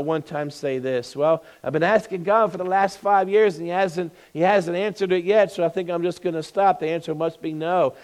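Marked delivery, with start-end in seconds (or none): none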